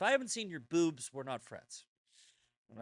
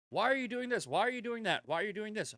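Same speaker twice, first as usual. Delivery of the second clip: higher, whiny nagging questions; mock nagging critic; 6 semitones higher, 3 dB louder, faster than usual. Mocking the critics who nag him about his posts. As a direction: needle him like a pestering critic.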